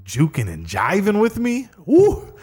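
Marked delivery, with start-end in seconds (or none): 1.8-2.3 sudden high delighted woo; excited whoop. Real, not acted. Recalling the dancing spiders, a high excited whoop. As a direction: whoop with glee at the memory.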